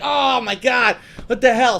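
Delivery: mock disgust. Reacting to a fake fart.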